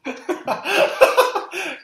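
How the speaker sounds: high, loud laughing burst; sudden high-pitched laugh; burst of laughter. Real, not acted. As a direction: crack up at the quote. Laughing at the badger quote.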